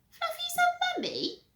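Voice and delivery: very high disbelief; shocked. Acted. Peter learning about Fluffy, high shock. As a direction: squeal in shock.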